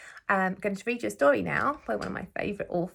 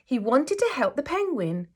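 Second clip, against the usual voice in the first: gentle, expressive storybook reading; teacher reading a picture book aloud to her class; reading to children; 4 semitones higher, 5 dB louder, slower than usual. A narrator's reading. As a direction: read it like story time for little ones.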